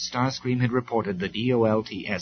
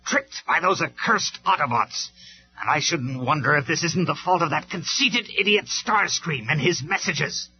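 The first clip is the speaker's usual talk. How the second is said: furious rant; 7 semitones higher, 4 dB louder than usual. A narrator's reading. Megatron raging at the Autobots and Starscream. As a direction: spit out the blame in fury. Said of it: "he stormed".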